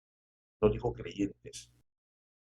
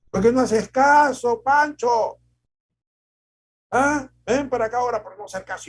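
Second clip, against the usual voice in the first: put-on much higher and louder, shouting at the kid; angry parent yelling; 13 semitones higher, 10 dB louder than usual. A father yelling at his son Pancho, furious. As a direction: yell at the disobedient child. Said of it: "Y nos gritaban".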